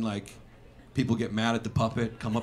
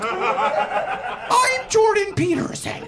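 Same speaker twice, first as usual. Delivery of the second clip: high, slow mock impression; a squeaky puppet Peterson; Jordan Peterson puppet; 4 dB louder, much slower than usual. Comic voicing a Jordan Peterson puppet, high and slow. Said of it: "puppet impression".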